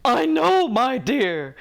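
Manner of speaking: put-on high, jolly old uncle voice; a giggly old gentleman; merry old man. A narrator's reading. Uncle Albert admits his weakness, merry and high. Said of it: "wiping a merry eye".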